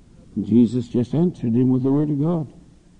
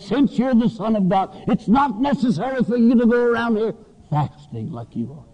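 raised, coaxing devil voice; a wheedling Satan, voiced higher; tempting devil; 8 semitones higher than usual. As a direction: sweet-talk him like the tempter, pitched up. Preacher voicing the devil tempting Jesus.

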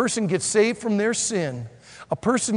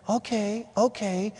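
small, soft acquiescence; meek agreement; 3 semitones higher, 5 dB quieter, slower than usual. Acted. Jehoshaphat sits there listening to the warning, soft meek agreement. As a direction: give in softly, as if unsure. Jehoshaphat meekly going along.

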